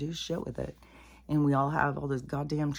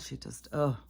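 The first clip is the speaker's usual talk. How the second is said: ordinary talk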